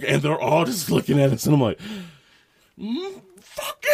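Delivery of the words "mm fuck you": drawn-out, very high squealed curse; mock outburst. Cursing the guard before shutting the door, mock outburst. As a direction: squeal the insult slowly, hamming it up.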